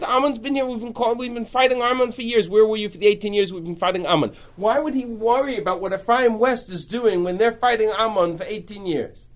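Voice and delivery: usual speaking voice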